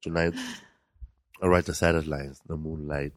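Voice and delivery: low, soft poetry reading; hushed poem recital. Retold or imitated. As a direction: read it like a romantic narrator on tape. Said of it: "Andy Garcia does a narration".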